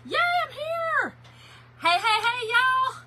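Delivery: high, loud cheer as she joins; excited squeal. Real, not acted. Host greets her live audience, excited squeal. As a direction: squeal with joy at getting in.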